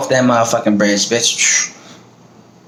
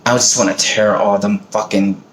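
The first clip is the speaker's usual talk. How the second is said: normal talking voice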